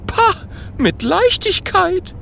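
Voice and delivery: scoff, then gloat about tricking the wizard; boastful ghost voice. A narrator's reading. Ghost Gerd brags to Kokosnuss, high and cocky.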